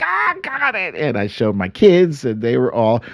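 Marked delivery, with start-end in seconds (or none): 0.0-0.9 excited high voice. Retold or imitated. Telling wifey the news.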